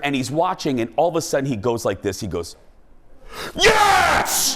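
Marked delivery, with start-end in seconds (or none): 3.6-4.6 high loud sports yell; retold shout. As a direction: bellow it like a goal was scored. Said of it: "he's screaming in my ear".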